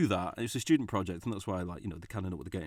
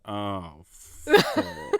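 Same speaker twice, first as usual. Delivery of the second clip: dismayed swear; 4 semitones lower than usual. Retold or imitated. Dismay at Italian couch role.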